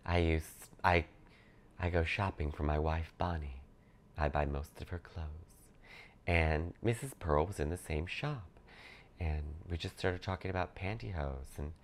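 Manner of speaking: soft, quiet Corky impression; lightly voiced meek Corky rambling. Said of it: "a Corky from Waiting for Guffman".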